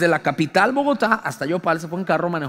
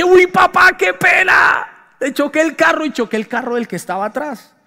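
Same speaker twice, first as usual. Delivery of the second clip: dismayed exclamation; 5 semitones higher than usual. Retold or imitated. Confessing crash to dad.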